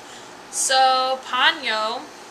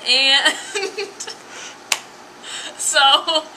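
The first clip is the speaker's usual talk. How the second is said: usual speaking voice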